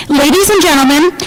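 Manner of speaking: ordinary talk, nothing marked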